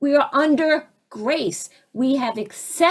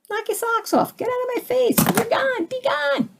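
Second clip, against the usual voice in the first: much higher, teasing brush-off; sing-song high put-down; mocking dismissal; 12 semitones higher than usual. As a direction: wave the attacker away with a lilting taunt. Dismissing an attacker.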